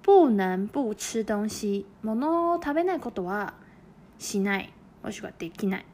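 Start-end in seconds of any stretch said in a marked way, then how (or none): 0.0-1.8 就算在減肥 drawn out syllable by syllable; slow model reading. Shown as a demonstration. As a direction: read it slowly for learners to copy. (ゆっくり模範読み)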